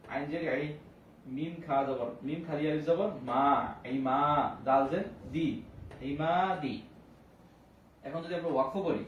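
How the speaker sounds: ordinary talk, nothing marked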